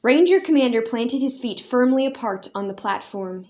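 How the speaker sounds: ordinary delivery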